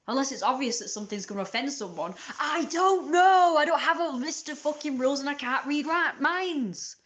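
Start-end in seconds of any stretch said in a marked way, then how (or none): 2.4-5.2 exasperated. Real, not acted. Exasperated rant about rules.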